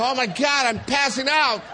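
high-pitched mock alarm; comic panic. Husband hyperventilating at the bedside, comic panic. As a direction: play a birth coach about to faint. Coach acts out fainting from hyperventilation.

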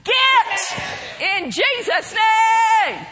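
high, loud, slow command; shouted rebuke. Shown as a demonstration. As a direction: drive it out with a shout. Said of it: "Let's practice that".

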